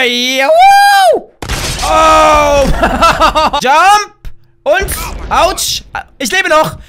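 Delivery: normal talking voice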